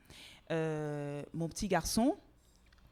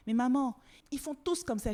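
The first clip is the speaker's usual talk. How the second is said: put-on child's protest; 8 semitones higher, 6 dB louder than usual. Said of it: "ils me disent".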